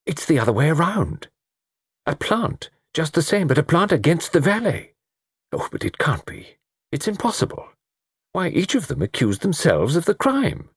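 high-pitched, amazed gentleman's voice; astonished amateur sleuth, pitch up; excited Mister Satterthwaite. Acted. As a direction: think aloud in bursts of disbelief.

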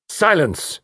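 high, ringing authoritative shout; firm command. Acted. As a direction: silence the room with one word. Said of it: "rang out firm and authoritative".

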